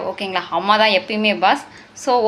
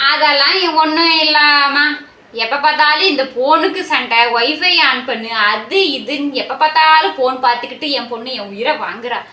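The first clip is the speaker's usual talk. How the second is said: exasperated mother; 5 dB louder than usual. Acted. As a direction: vent about a nagging daughter. Mother rants about daughter's phone. (சலிப்போடு உரக்கப் புலம்பும் அம்மா)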